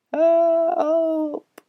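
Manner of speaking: a thin lost voice calling down; called out. Acted. Pipkin the ghost calling down to the boys, thin and high. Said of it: "said Pipkin".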